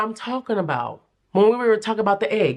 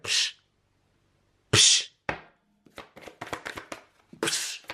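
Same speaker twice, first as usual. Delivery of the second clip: dismissive psh; 5 dB quieter, slower than usual. Retold or imitated. Spirit dismissive sound.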